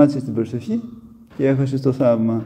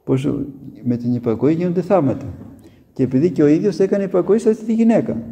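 ordinary delivery

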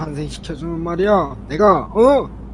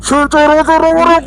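much higher, much louder shout; to someone hard of hearing; 11 semitones higher, 8 dB louder than usual. Shown as a demonstration. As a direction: shout it so a deaf ear catches it. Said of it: "오케이, 오케이".

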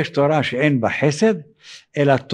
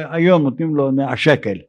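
ordinary talk

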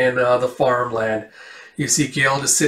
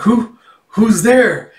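higher, louder startled question; 8 semitones higher, 5 dB louder, much slower than usual. Acted. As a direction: call out in surprise to a stranger. Startled girl answering a mysterious voice.

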